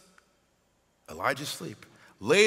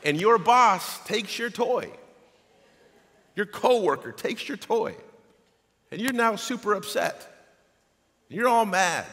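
mock upset; 6 semitones higher, 5 dB louder than usual. Preacher acting out adult frustration.

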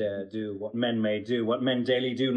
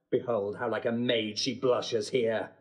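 ordinary talk

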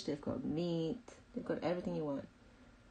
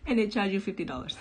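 laughing; 3 semitones higher, 6 dB louder, faster than usual. Real, not acted. Laughing about price.

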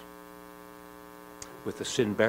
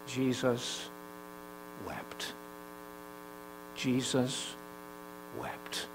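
hushed; 8 dB quieter, much slower than usual. Acted. Solemn repeated verse.

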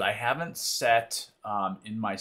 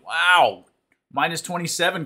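loud, amazed wow; a big shocked wow; surprised; 9 semitones higher, 11 dB louder than usual. Real, not acted. Reacting to the cold.

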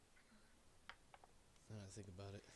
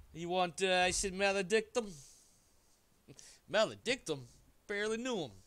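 loud, high punchline delivery; hammed-up pun; 7 semitones higher, 11 dB louder than usual. Real, not acted. Hamming up a pun loudly.

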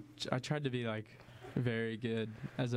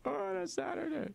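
very high cartoon outburst; mock complaint. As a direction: squeal the gripe like the meme. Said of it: "and I'll be like".

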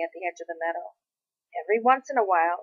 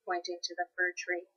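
usual speaking voice

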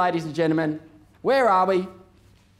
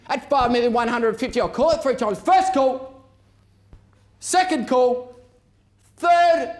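auctioneer calling; 4 semitones higher, 3 dB louder than usual. Real, not acted. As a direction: build the tension, call after call. Calling the three calls.

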